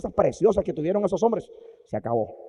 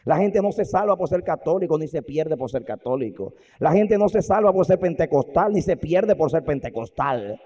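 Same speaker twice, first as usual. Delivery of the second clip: loud, slow preaching; 3 semitones higher, 8 dB louder, slower than usual. Real, not acted. Preacher hammering the message to his congregation. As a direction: drive the point home.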